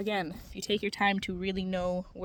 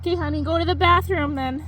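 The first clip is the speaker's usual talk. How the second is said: calling out; 6 semitones higher, 11 dB louder than usual. Real, not acted. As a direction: call across the yard. Calling out to child elsewhere.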